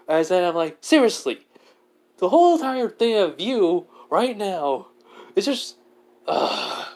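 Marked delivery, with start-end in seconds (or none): 0.8-5.8 high-pitched exasperated complaint; fed-up, incredulous griping. Acted. Mad character complains at overlord. 6.3-6.9 very low "ugh"; low groan of exasperation; exasperated groan. Acted. Disgusted groan at overlord.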